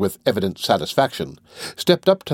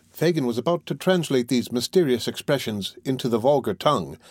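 plain reading voice

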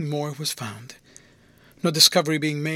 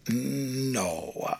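long drawn-out low no; pondering, stretched-out no; deliberating; 4 semitones lower, 5 dB quieter than usual. A narrator's reading. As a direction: sink into a slow, considered refusal. Dean deliberates, then declines.

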